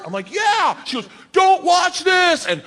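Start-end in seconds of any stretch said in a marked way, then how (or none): 0.3-0.8 startled, pitched-up acted shout; high yelled reply. Comic answers host before her jump, high tense shout. 1.3-2.4 slow, high, loud mock shout; shouted warning. Host warns comic as she jumps, high slow shout.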